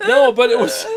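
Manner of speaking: bursting into laughter mid-sentence; laughing. Real, not acted. Host laughing to co-host about the amp's new sound. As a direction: laugh it out, high and loud.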